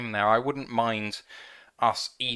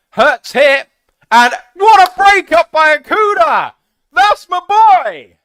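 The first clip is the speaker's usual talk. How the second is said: ecstatic shout; 16 dB louder than usual. Real, not acted. Fan celebrating Okudah's breakup with a screaming high cheer. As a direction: erupt as your guy breaks up the pass.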